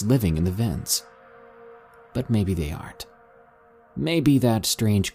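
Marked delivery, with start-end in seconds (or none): none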